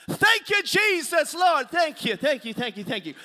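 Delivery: loud acted shouts of praise; hammed-up relieved praise, starting high; jubilant thanks. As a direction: holler thanks to heaven, then let it tumble down. Jubilant prayer after hanging up.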